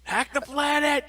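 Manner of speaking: high, slow mock battle cry; movie catchphrase yell. Quoting Hackers to a friend, high slow rally cry.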